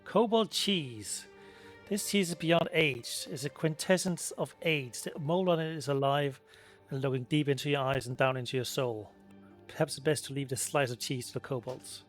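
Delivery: ordinary talk, nothing marked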